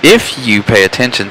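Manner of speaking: ordinary talk, nothing marked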